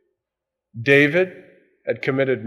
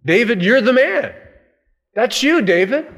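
higher, louder pointing finger; forceful accusation; 9 semitones higher, 4 dB louder than usual. Acted. Preacher repeating Nathan's charge to David, loud and higher. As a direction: drive the accusation home hard.

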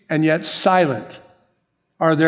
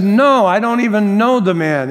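higher, louder protest; emphatic denial; 6 semitones higher, 6 dB louder than usual. Acted. Peter denying Jesus to the maid, emphatic acted denial. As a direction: deny it hotly, as an acted quote.

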